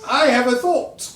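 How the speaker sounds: high, loud silly voice; Monty Python bit. Retold or imitated. GM quoting a Monty Python sketch in a high, loud silly voice. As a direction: announce the thought like a pompous goof. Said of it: "that dude in Monty Python".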